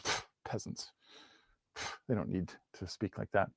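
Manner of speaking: soft, low scoffing aside; playfully snobbish dismissal; mock disdain. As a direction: puff dismissively, then toss it off under your breath. Mocking high-society disdain for peasants.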